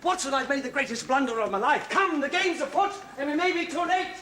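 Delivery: high, loud alarm; rally a companion in great haste; agitated cry to get moving; urgent shouting. Acted. Alarmed, urging companion to hurry.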